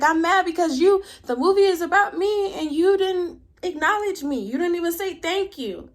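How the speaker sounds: high-pitched mock grievance; imitated angry girlfriend. Girlfriend confronting boyfriend over the movie, imitated arguing. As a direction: act out her complaint, pitched well up. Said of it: "eventually they start arguing".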